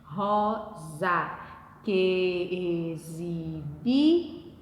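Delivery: "Rosa que exibi" very slow and low, syllable by syllable; drawn-out low syllables in "Rosa que exibi"; counting poetic syllables. Shown as a demonstration. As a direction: chant out "Rosa que exibi" in long separate syllables, stopping on "bi". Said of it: "existem cinco sílabas poéticas".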